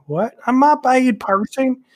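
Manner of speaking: high, puzzled repeat of the question; confused reply. Retold or imitated. Retelling his own baffled answer to Brett, high. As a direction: echo it back in disbelief. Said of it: "I'm like".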